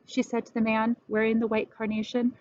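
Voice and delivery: ordinary delivery, nothing marked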